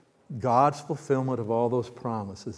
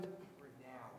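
whispered; 27 dB quieter than usual. Acted. Repeating the slogan.

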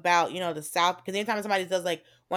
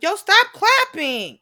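loud, high, slow order to stop; exasperated shout; 12 semitones higher, 10 dB louder, slower than usual. Retold or imitated. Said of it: "George Lopez was like".

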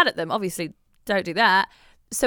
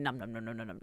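munching noise; 9 semitones lower, 14 dB quieter, faster than usual. Retold or imitated. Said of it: "so I was going".

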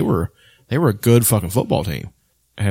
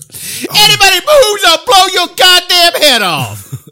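high, loud, slow shouted threat; Samuel L. Jackson impression; 7 dB louder, slower than usual. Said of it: "You do a pretty good Samuel L. Jackson".

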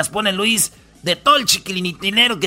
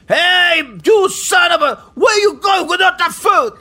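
shouted high, in English, slowing at the end; angry shout; 9 semitones higher, 4 dB louder, slower than usual. Retold or imitated. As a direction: yell at a fleeing shopper.

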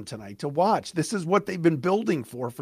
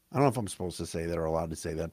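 quick quiet aside; 13 semitones lower, 8 dB quieter, much faster than usual. Real, not acted. Swearing aside to audience.

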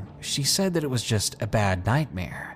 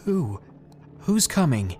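trembling voice; 5 semitones higher than usual. Retold or imitated. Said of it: "I asked in a trembling voice".

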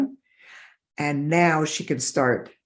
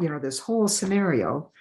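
normal talking voice

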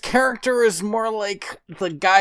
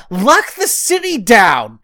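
loud, drawn-out, raised outrage; exasperated rant; 6 semitones higher, 7 dB louder, slower than usual. Retold or imitated. Host scolds the king over his search plan, exasperated shouting. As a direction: hammer each point in mock fury. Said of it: "drove me up the fucking wall".

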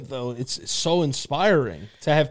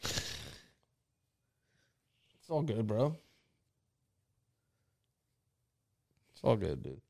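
soft, low-key comfort; hushed and tender, leaving long pauses; real gentle reassurance; 3 semitones lower, 12 dB quieter than usual. Real, not acted. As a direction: quietly tell a friend it's okay. Comforting friend who is crying.

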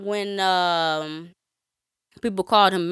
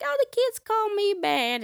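put-on little girl voice; 12 semitones higher than usual. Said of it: "she was like".

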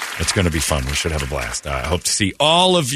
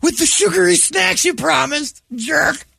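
put-on kid shouting. Kid yelling after a car.

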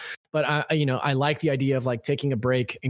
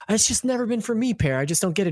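whiny self-imitation; 8 semitones higher than usual. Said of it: "And I'm always like".

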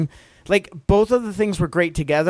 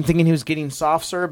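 usual speaking voice

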